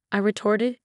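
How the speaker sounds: ordinary delivery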